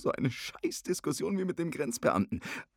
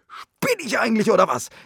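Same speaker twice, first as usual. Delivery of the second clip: luid en hoger, boos uitgebarsten; woedend; 7 semitones higher, 12 dB louder than usual. Acted. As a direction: schreeuw het verontwaardigd uit. Ruzie, hij barst uit.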